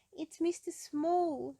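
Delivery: high, slow little voice; Mister Small. Acted. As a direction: announce himself, small and polite. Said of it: "said Mister Small".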